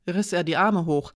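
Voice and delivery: usual speaking voice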